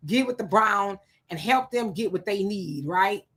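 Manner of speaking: usual speaking voice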